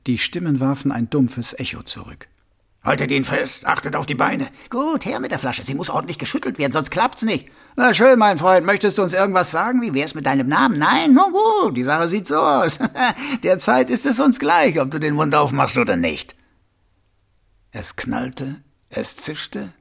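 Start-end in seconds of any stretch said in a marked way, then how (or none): 2.8-7.5 gruff guard voices. Acted. Guards staged torture act. 7.7-10.8 menacing guard. Acted. Guard mock interrogation. 10.8-16.4 sneering guard. Acted. Guard mock interrogation, laugh.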